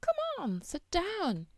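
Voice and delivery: high, swooping, soft invitation; coaxing old maid. A narrator's reading. Old maid invites the princess to the spindle, coaxing. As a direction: coax in a high sing-song.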